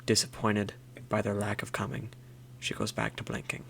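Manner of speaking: hushed wildlife narrator voice; nature documentary narration. Pretend. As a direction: narrate like a whispered nature film. Whispered nature documentary parody.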